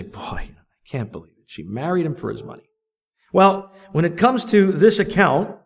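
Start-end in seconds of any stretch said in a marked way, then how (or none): 0.0-2.6 hushed, quick whispered gossip. Retold or imitated. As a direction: lean in and whisper it like juicy gossip. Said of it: "you look at those women and you say".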